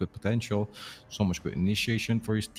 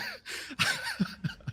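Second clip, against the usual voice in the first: high laugh; 5 dB quieter than usual. Real, not acted. Laughs mid-joke about swapping shirts.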